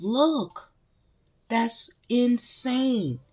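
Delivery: astonished exclaim; amazed. Real, not acted. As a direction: struck by what she sees. Reacting to card, amazed.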